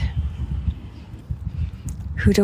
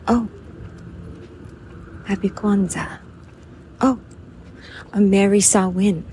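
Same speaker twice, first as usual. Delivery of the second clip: a touch of performed well-wishing, with long gaps between greetings; holiday bidding; 4 dB quieter than usual. Acted. Bidding good wishes to someone in conversation, lightly acted greeting. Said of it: "we say this to each other in a bidding".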